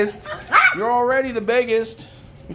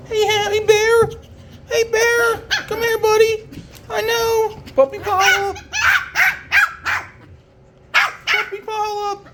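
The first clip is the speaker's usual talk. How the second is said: much higher doting coo to the pups; 14 semitones higher than usual. Real, not acted. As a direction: gush over the little puppies. High baby talk to puppies.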